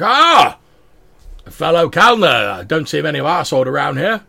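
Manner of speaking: high, loud cry easing into booming talk; bellowing dwarf. Acted. Dwarf spotting one of his own sort, bellowing. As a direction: boom in delighted recognition. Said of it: "It's like".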